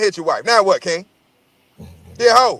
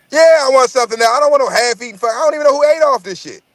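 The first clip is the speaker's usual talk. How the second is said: higher and louder, exasperated; heated retort; 8 semitones higher, 4 dB louder, faster than usual. Retold or imitated. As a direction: fire back at the waiter, fed up. Acted retort to the waiter.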